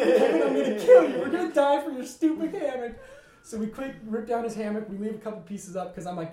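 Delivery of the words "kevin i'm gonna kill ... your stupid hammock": loud, high mock fury with laughter; exasperated yelling. Camper fleeing danger scolds roommate over hammock, mock-furious yell.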